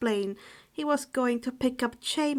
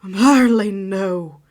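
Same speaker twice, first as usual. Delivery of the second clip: loud shocked cry; alarmed outburst; 3 semitones lower, 13 dB louder, slower than usual. Acted. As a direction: panic at the thought. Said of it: "Remus exclaimed with wide eyes".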